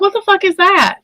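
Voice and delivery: high, loud shocked exclamation; startled and outraged. Retold or imitated. Recalling the message, a loud shocked outburst. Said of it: "Oh, I was like".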